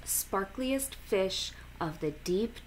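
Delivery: ordinary delivery, nothing marked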